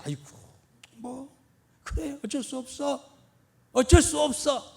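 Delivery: high, resigned sigh of a quitter; giving up. Retold or imitated. A believer giving up on faith, mock resigned. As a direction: voice someone who has given up. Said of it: "그냥 자포자기할 수도 있어요".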